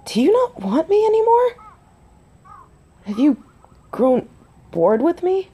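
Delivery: high, rising, wounded questions; insecure questioning. Acted. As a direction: plead for an answer, pitch climbing. Worried partner questions werewolf mate.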